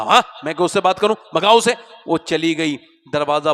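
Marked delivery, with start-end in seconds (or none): 0.0-1.7 louder, scornful brush-off with a laugh; a retold prophet's loud scornful dismissal; dismissive retort. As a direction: shoo her away, half laughing. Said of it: "تو کہا".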